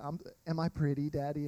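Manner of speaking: lightly voiced little daughter, soft; a hint of a small girl asking sweetly. Daughter asking her daddy, softly voiced little girl. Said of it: "He's like".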